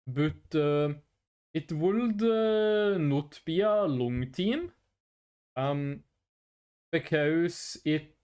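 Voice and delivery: put-on low, slow Norwegian accent. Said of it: "I would have to speak in my, uh, Norwegian accent".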